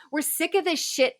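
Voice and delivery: fed up, voice lifting in real exasperation; worked up. Real, not acted. Host addressing Oprah about recurring narratives, worked up. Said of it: "obviously getting worked up".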